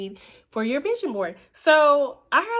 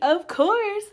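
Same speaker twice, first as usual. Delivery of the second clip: usual speaking voice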